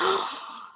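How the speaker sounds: sharp mock-horrified intake of breath; shocked gasp. Daughter hearing mum's mistake, shocked gasp. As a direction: gasp as if appalled, retelling it.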